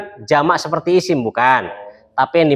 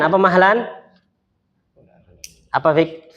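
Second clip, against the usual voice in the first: ordinary delivery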